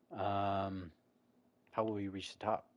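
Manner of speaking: puzzled. A narrator's reading. Traveler asks the dwarf about the tower, puzzled. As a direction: ask it with a touch of confusion. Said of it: "you ask, puzzled".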